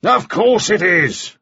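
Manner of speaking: high, drawn-out irritated shout; shouted retort. A narrator's reading. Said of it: "shouted Mannering".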